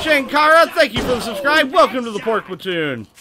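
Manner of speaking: high, loud welcome for a new subscriber; hyped shout-out. Real, not acted. Streamer welcoming a subscriber, loud and excited. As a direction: call out the name big and proud.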